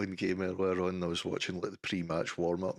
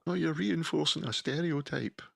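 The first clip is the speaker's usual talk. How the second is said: whiny mock offense; 9 semitones higher than usual. Mocking offended critics.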